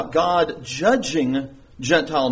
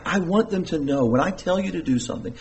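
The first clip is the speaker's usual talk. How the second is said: ordinary talk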